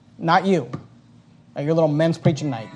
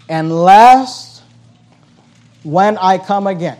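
scripture reading, preached; 3 semitones higher, 8 dB louder, much slower than usual. Acted.

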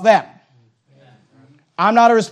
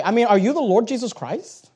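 ordinary talk, nothing marked

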